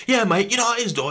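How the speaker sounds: high, loud-mouthed mate act; loud one. Retold or imitated. Imitating a loud mate chatting to the police, loud and high. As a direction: put on the loud bloke, pitched right up. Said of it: "he's a loud one".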